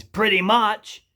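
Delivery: loud, high, drawn-out verdict; imitated blunt tradie. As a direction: stretch it out as the tradie's last word. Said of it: "And he goes".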